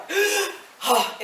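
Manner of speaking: exaggerated last-second intake of breath; loud sudden gasp. Teacher mimicking choir men just before singing, loud gasp. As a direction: suck the air in sharply. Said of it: "they go".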